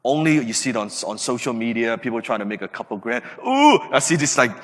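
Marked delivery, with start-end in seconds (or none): 3.4-3.8 high, loud mock gasp of delight; a mock impressed ooh. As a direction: squeal it like a showy young guy. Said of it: "young guys posting on social media".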